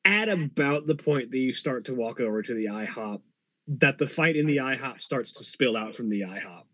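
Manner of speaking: normal talking voice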